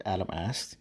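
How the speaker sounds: ordinary delivery, nothing marked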